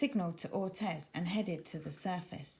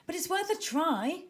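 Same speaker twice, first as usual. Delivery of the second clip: eager cry; 9 semitones higher, 4 dB louder than usual. Acted. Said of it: "cried Ortez".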